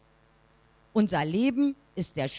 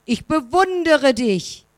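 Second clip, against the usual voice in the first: higher, louder slow shout into the mountains; boy shouting admiration into the hills; 7 semitones higher, 8 dB louder than usual. A narrator's reading. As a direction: call it out far across the valley. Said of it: "Dann schreit er in die Berge".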